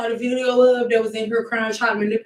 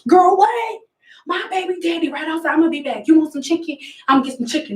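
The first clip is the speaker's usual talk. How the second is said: much higher, sassy stylist voice; 7 semitones higher than usual. Retold or imitated. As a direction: play the distracted stylist chatting away.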